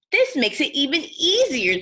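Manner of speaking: high, loud, gleeful wolf line; chuckling wolf. Acted. Wolf gloating to himself, chuckling. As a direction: gloat over the easy way in. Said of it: "he chuckled".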